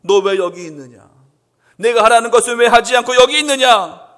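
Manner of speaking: stern loud reproach; rebuke. Acted. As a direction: scold the one hiding. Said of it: "하나님이 나에게 책망하십니다".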